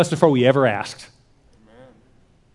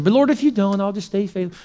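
higher, mock backpedalling plea; hedging prayer; 4 semitones higher than usual. Believer gives God an out on depression, hedging. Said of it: "Isn't it funny how we do that?".

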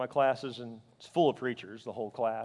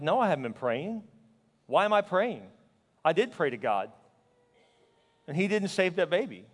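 louder, bitter protest; retold anger; 5 semitones higher, 6 dB louder than usual. Grieving father to best friend about unanswered prayer, mad protest. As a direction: throw the question back, hurt and mad. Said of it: "I got mad".

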